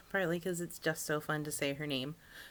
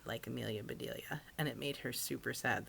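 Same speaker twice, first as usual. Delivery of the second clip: whispered aside; 5 semitones lower, 5 dB quieter than usual. Real, not acted. Aside about mom disliking book.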